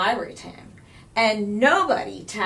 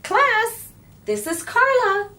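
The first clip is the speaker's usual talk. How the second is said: cheery teacher; 13 semitones higher, 7 dB louder than usual. A narrator's reading. Teacher introducing new student.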